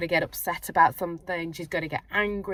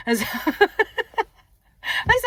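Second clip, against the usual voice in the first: laughing; 12 semitones higher, 4 dB louder, much slower than usual. Real, not acted. Laughing aside to viewers.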